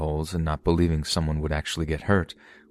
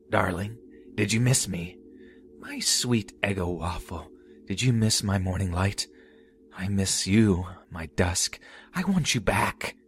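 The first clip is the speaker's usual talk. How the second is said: high, eerie crooning stalker; lightly voiced mad jester, sweetly menacing; creepy clown voice; 3 semitones higher than usual. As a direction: croon it like an unhinged lover. Stalker clown speaks to victim.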